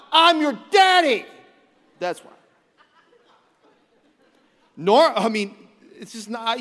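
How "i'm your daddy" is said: slow, much higher, loud shout; hammed-up authority, bellow it; mock dad shout. Dad ending the argument with his kids, hammed-up shout.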